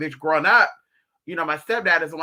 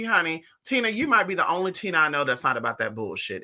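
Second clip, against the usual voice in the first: normal talking voice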